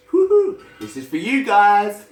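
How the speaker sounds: high, loud team whoop; a shouted woohoo for the team; excited cheer. Real, not acted. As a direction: celebrate the team with real joy.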